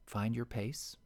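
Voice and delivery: ordinary delivery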